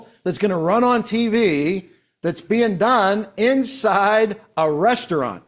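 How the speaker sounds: slow, loud, word-by-word announcement; emphatic repeat. Real, not acted. Repeating the big news to colleagues, slowly emphatic. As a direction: punch "commercial", "T V" and "inside", drawing them out. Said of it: "Let me repeat that".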